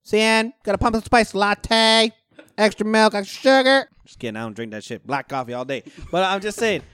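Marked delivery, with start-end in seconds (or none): 0.0-3.9 high, slow barista sing-song; high barista voice reading a cup; mock barista. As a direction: call out the order like a chirpy barista. Mocking barista order.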